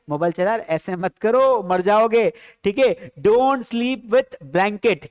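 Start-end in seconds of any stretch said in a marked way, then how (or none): 3.2-5.1 very slow, word by word, for the class; slow model sentence. Shown as a demonstration. Teacher dictating an English sentence to students, very slowly. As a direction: spell each English word out slowly for learners. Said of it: "क्या बोलेंगे?".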